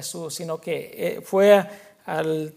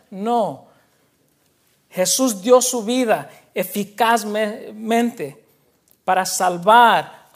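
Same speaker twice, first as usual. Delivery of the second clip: louder, driving sermon delivery; forceful preaching; 4 semitones higher, 5 dB louder than usual. Acted. Pastor driving home his point to the church, forceful preaching. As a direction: preach it out to the congregation.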